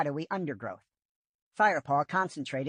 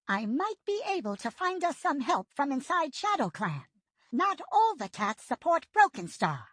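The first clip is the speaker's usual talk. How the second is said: put-on higher, urgent old she-cat voice; Yellowfang's voice; 11 semitones higher than usual. A narrator's reading. Old she-cat offering help from Shadow Clan, higher voice. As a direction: voice the old medicine cat, hopeful.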